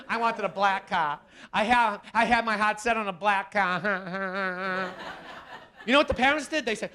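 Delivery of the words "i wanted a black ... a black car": put-on slow, drawn-out spoiled teen whine; a lightly voiced pouting teenage girl; whiny spoiled teen. Spoiled daughter rejecting the car's color, slow whine.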